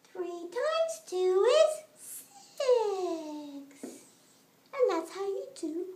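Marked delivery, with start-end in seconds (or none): 0.1-3.9 very slow, drawn-out reveal. Acted. Teacher slowly revealing the answer to the class. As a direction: draw every word out for suspense.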